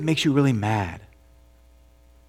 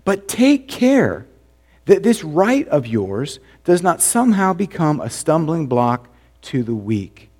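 slow solemn warning; 3 semitones higher, slower than usual. Acted.

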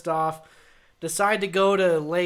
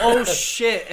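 raised, higher mock cry of excitement; retold scream; 4 semitones higher, 4 dB louder than usual. Friend recounting the staged catch video, mock scream. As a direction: put on an excited yell, only half full. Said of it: "I'm screaming".

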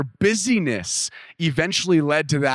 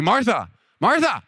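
higher, gently soothing Martha, Martha; Jesus soothing an upset Martha, higher pitch; calming Jesus; 7 semitones higher than usual. Retold or imitated. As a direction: say Martha, Martha to calm her down. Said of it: "those two Marthas are to calm her down".

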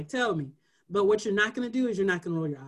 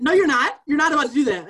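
higher, louder scolding teacher voice; stern teacher; 6 semitones higher, 8 dB louder than usual. Retold or imitated. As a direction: call out a student firmly.

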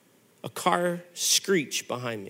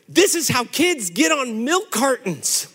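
ordinary talk, nothing marked

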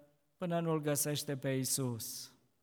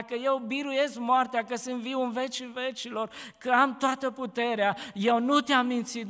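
raised, fervent declaration to Mary; Jesus reassuring; 4 semitones higher than usual. Acted. Risen Jesus shows Mary his hands, fervent. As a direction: proclaim it, rising with conviction.

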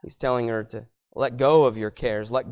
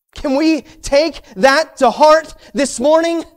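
preaching; 8 semitones higher, 7 dB louder than usual. Acted. Preacher driving the point home to the congregation, loud and high. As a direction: build it up from the pulpit.

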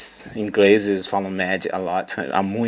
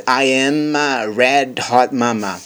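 slow, higher-pitched teaching model; slow reading; 4 semitones higher, much slower than usual. Shown as a demonstration. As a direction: draw out each word of the line. Teacher reads line slowly, then normally.

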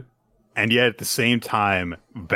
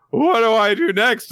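flustered Gakuro; 11 semitones higher, 4 dB louder than usual. Retold or imitated. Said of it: "Gakuro is like".

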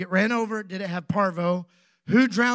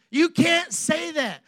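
high, slow shout at the ladies; playful shout; 8 semitones higher, 4 dB louder, slower than usual. Real, not acted. Pastor playfully shouting at the ladies in the congregation. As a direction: call it out big and teasing.

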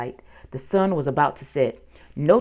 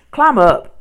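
loud, higher-pitched command; urgent call; 5 semitones higher, 10 dB louder than usual. A narrator's reading. Girl urges her brother up into the treehouse, calling loudly. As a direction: call down to hurry someone up.

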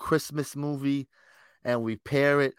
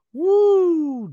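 excited whoop; 9 dB louder than usual. Real, not acted. Hyped cheer.

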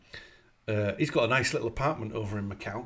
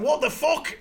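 high, loud what-the-hell cry; shocked outburst; 6 dB louder than usual. Retold or imitated. Kenneth sees the wrong painting, shocked high cry. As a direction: caught off guard, blurt it out. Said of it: "and he's like".

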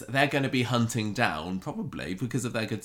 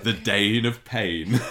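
wrestling commentator; 5 dB louder, much slower than usual. Acted. Joins the announcer game.

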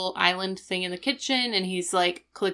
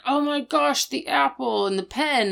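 higher exasperated cry; 7 semitones higher than usual. Retold or imitated. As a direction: throw up your hands, higher pitch. Rachel exasperated quote.

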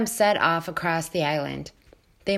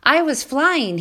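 high, loud squeaky little voice; squeaky gosling; 9 semitones higher, 6 dB louder than usual. Acted. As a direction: squeak it out, thrilled. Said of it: "he squeaked".

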